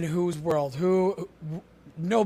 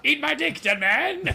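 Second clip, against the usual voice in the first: high hammy villain taunt; sneering panto villain; 10 semitones higher, slower than usual. Pretend. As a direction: play a thirties cartoon baddie. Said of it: "that's old school right there, like thirties villain".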